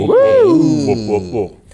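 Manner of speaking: loud whoops starting high, then dropping; cheering whoops. Acted. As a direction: whoop it up for the show opening. Cheering the show opening.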